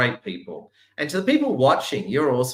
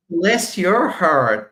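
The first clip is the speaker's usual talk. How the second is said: slow, higher, louder bless your heart; mock Southern blessing; 4 semitones higher, 5 dB louder, much slower than usual. Guest blessing the audience in a slow mock Southern drawl. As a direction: drawl it out like a sweet Southern auntie.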